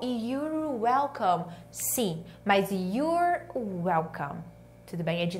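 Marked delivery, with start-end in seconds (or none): 0.1-1.5 drawn-out, very slow reply; slow model pronunciation. Shown as a demonstration. Slow model phrase for learners. 2.7-4.4 very slow, stretched words; slow model pronunciation. Shown as a demonstration. Slow model phrase for learners.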